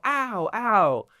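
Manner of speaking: high hammed-up ow cries; mock pained yelps. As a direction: yelp like a beaten goblin. Acted hurt goblins.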